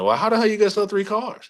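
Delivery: incredulous, mock-scolding retort; exasperated challenge. Manager to young salesman, high incredulous challenge.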